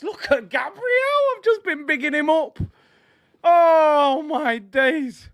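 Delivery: high, drawn-out disbelief; dismayed outcry. Real, not acted. Fan who had been bigging Gabriel up, high dismayed cry. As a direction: wail at the player who let you down. Dismayed at defender after goal.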